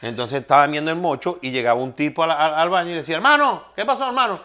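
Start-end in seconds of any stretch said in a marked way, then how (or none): 3.2-4.4 much higher, slow buddy voice; man in the joke. Acted. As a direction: call out to a pal in the bathroom. Man in joke asks for change.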